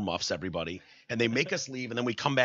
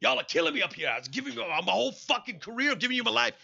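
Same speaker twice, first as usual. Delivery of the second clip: higher, wailing mock plea; mock pleading defendant; 9 semitones higher than usual. Host imagining himself in the interview, mock wailing. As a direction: beg like a desperate accused man. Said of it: "I'm like".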